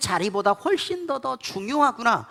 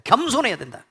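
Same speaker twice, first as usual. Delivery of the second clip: normal talking voice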